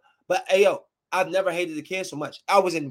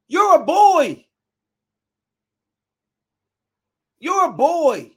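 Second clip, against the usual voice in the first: high, loud outburst of realization; raised, swooping exclamation; loud accusation; 7 semitones higher, 6 dB louder, slower than usual. Retold or imitated. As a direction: call it out in disbelief. Retold shocked accusation at the kid.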